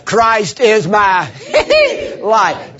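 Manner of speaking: slow build to a squealed whoop before "life"; stress on life. Shown as a demonstration. Preacher redoing what the crowd missed, whoop into "life". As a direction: hold back, squeal high, then land "life". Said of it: "Oh, you missed something there".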